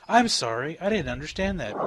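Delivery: automated phone voice. Retold or imitated. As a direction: mimic a cheerful phone robot. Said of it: "those automated phone systems".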